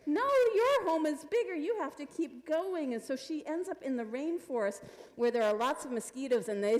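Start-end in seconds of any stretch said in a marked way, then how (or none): 0.1-2.9 loud, high opening, easing down; emphatic storybook animal voice, starting very high; sheep character. Acted. Sheep answering the girl in story.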